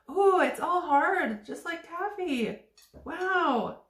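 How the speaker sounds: surprised. Real, not acted. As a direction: react with delight. Discovering candy hardened.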